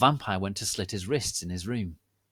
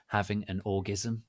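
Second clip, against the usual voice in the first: ordinary delivery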